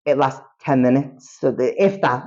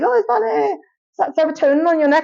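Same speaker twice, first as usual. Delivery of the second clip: much higher-pitched mock teacher voice; curious science teacher; 14 semitones higher than usual. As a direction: imitate a teacher asking, pitched way up. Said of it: "my daughter's science teacher was like".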